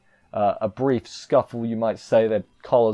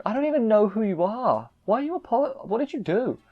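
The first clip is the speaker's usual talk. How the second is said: high-pitched imitation of bafflement; mock bewildered, much higher voice; baffled retort; 10 semitones higher than usual. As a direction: act out confusion, voice pitched way up. Acting out a baffled Kyo.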